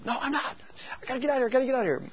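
high, very fast, rushed panic; frantic protest. Retold or imitated. Sleeping girl answers back in a rush, high frantic panic. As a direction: blurt it out, desperate to escape.

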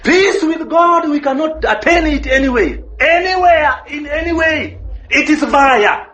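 fervent preacher pressing the point home; preaching at full force. Acted. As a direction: preach it out, driving each phrase home. Preaching climax, raised voice.

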